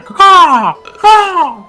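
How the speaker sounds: raven caw. Pretend.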